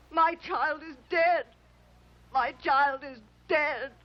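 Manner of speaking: sobbing insistence. Acted. Mother to the pleading girl, sobbing insistence. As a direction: repeat it through tears.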